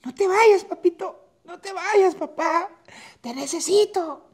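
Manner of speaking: put-on very high, loud child crying for his father; wailing child. Boy at his father's funeral begging him to stay, high loud wail. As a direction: wail it like a small boy clinging to the hearse. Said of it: "Y gritaba".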